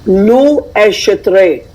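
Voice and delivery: "Lu esce tre" said very slowly, like "loo esh tray"; dialect pronunciation. Shown as a demonstration. Woman teaches her favorite Itanglish word slowly to her cousin. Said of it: "my favorite bastardized Italian English word".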